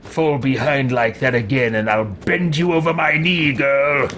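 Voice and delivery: imperious, put-on character voice; lordly, scolding menace; haughty threat. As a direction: warn a lagging underling who is beneath you.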